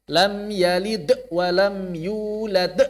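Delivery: "yalid" and "yulad" with a jerked, bouncing final d; slow recitation, jolt the d in "yalid" and "yulad"; qalqalah terhentak. Shown as a demonstration. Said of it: "jangan membaca qalqalah terhentak-hentak". ("yalid" dan "yulad" dengan pantulan d yang dihentak)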